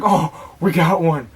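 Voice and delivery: sudden loud, high cry of excitement; excited shout. Retold or imitated. Fishing buddy calls out thinking they caught a fish, excited shout. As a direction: yell it like you just hooked a big one.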